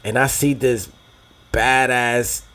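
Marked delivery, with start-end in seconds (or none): none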